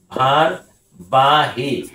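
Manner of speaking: ভারবাহী drawn out very slowly; teacher dictating ভারবাহী slowly; very slow dictation. Shown as a demonstration. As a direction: stretch ভারবাহী for the class. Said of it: "teacher repeats word very slowly".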